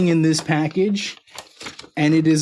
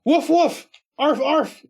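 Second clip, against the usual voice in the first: high-pitched spoken woofs and arfs; dog barks; 11 semitones higher, slower than usual. Acted. Reading Gordo's message for Tommy from the letter, high dog barks. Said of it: "tell Tommy that Gordo said".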